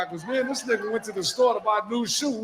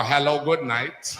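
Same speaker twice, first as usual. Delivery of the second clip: mock Jamaican greeting; 5 semitones lower, slower than usual. Imitating Jamaican phone greeting.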